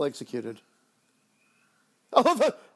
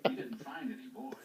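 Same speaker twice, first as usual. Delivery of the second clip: whispered quote; 8 semitones higher, 17 dB quieter than usual. Retold or imitated. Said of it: "He doesn't say it like that at all".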